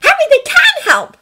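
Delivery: high, loud urgent plea; insistent high cry. A narrator's reading. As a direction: break in urgently, pitched very high. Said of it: "her voice urgent".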